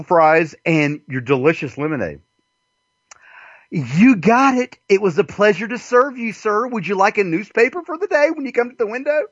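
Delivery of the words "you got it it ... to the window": high, eager customer-service voice; lightly voiced overly courteous clerk; mock polite cashier. Cashier serving the customer at the window, high and eager.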